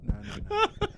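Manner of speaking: laughing. Retold or imitated. Mocking friend, crying laugh.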